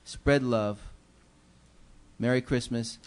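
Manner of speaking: normal talking voice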